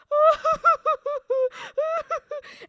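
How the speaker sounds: high, loud cackle; shrieking giggle fit; retold laughing fit. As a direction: burst into a high, pulsing laugh. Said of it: "then me just going".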